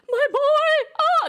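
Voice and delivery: high, loud hammed-up joyful wail; mock sobbing. Monarchs see their rescued child, mock sobbing. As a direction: wail like an overjoyed sobbing parent. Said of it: "They're, like, crying, sobbing, like, snotty crying".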